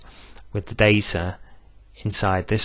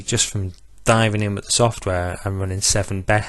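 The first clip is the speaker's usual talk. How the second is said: ordinary delivery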